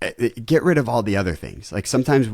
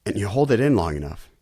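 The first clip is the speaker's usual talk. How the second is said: ordinary talk, nothing marked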